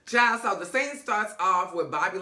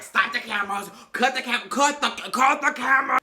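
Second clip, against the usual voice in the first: rising higher and louder each time; urgent cut; 6 semitones higher, 5 dB louder than usual. Retold or imitated. Bobby demands the crew stop filming, growing louder and higher. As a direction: escalate the panic on each cut. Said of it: "he was like".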